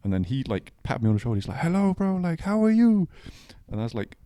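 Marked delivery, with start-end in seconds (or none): 1.6-3.1 put-on high-pitched Italian-accented greeting; imitating an effusive Italian barman; Italian bartender. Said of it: "the bartender".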